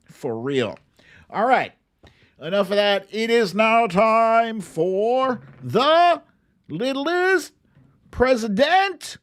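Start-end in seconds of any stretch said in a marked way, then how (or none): 3.1-9.2 slow, high, booming announcer voice; grand title announcement. Pretend. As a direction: announce it like a show host, drawn out. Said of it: "announcing a book title".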